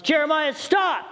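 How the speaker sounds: urgent shout, pitched high; high warning shout to a son on a bike; retold scream. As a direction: yell it like a child is in danger. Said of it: "when I screamed at the top of my lungs".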